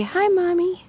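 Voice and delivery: put-on squeaky baby-talk prompt; high cute voice. Shown as a demonstration. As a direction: pitch it way up like a toddler. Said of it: "Can you say".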